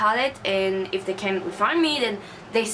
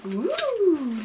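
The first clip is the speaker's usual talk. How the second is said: high, delighted little ooh; 8 semitones higher than usual. Real, not acted. Opening the box, she coos in delight. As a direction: coo at something pretty. Admiring the palette colours.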